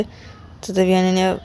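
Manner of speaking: ordinary delivery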